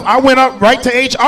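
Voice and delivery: usual speaking voice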